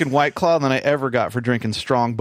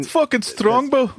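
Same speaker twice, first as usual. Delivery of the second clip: put-on high-pitched comic Irish accent; hammed-up amateur Irish accent; attempted Irish accent; 11 semitones higher than usual. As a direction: imitate an exasperated Irish drinker, pitched up. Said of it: "I wish I could do an Irish accent".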